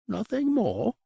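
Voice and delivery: high, disbelieving demon; can't believe the order is so small; incredulous. A narrator's reading. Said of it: "it asked incredulously".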